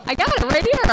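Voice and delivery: triumphant at having the nickel; eager shout to the postmaster; excited. Retold or imitated. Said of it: "I was all like".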